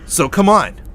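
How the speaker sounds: loud comic-villain challenge; big, punchy trash talk; fired-up threat. Acted. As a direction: call them out at full volume. Threatens his doppelgangers, calls them out.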